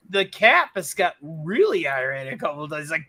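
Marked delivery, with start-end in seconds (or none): none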